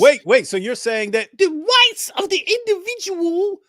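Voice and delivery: high, strained parody voice; high-pitched mock outrage at the host's logic. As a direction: squeak it out like a shocked caricature. Mock-voicing the host's argument.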